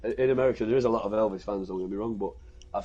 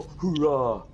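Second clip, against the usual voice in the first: a raised, hammed-up army cheer; mock American hoorah; 4 semitones higher than usual. British soldier mocking the American army cheer. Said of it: "it's not our character to go".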